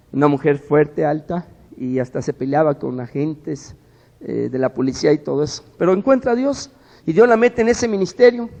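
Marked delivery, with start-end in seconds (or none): 5.8-8.5 preaching emphasis. Acted. Preacher lifts voice on conversion.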